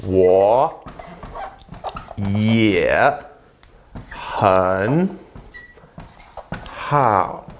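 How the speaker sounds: 我 也 很 好 each given alone with long pauses; word by word Mandarin. Shown as a demonstration. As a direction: break 我也很好 into single syllables. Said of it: "Yeah means again also".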